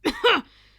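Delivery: loud hacking cough; harsh cough. Acted. Bakugo coughing after teleporting in. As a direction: cough hard, choking. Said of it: "Bakugo coughed".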